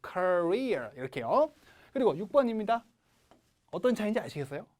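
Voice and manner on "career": career as kuh-REEER, second syllable stretched; stress on second syllable. Shown as a demonstration. Teacher pointing out the stress, career with long strong REER. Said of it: "두 번째 음절".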